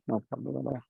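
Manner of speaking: ordinary talk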